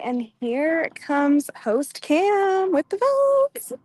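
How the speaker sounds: loud, slow announcer build, rising high; hyped announcement. Real, not acted. Host announcing Cam bringing the votes, hyped announcement. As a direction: draw it out and lift to a big finish.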